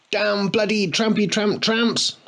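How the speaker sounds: high, slow furious tirade; raging. A narrator's reading. Boy cursing the tramps for the bike, high rage. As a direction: spit each word out in rage. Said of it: "raged Harry".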